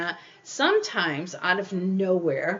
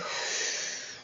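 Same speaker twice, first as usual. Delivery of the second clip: a demonstrated breathy sigh, letting it all out; loud exhale; 8 dB quieter than usual. Shown as a demonstration. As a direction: exhale loudly for the listener. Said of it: "exhale loudly".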